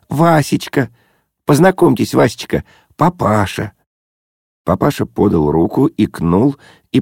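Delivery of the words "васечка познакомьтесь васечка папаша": high excited introductions; joyful chirping. A narrator's reading. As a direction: chirp the names out gladly. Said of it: "радостно защебетала Зося". (радостное щебетание)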